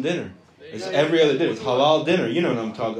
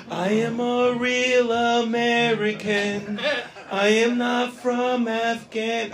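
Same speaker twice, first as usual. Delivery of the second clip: slow, drawn-out mock declaration; put-on all-American; 4 semitones higher, much slower than usual. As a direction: stretch every word out, slowly and deliberately. Mock proof of being American.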